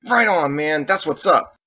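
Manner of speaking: loud, high whoop of approval; enthusiastic cheer. Acted. Ditch cheers Michael's speech, loud and excited. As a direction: cheer him on with gusto.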